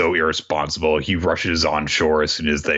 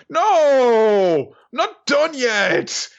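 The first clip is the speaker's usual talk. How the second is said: much higher, louder, very slow; stubborn drawn-out refusal; 4 dB louder, much slower than usual. Retold or imitated. Oden refuses Izo's order to go home, drawn out. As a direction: drag out the no like a defiant kid.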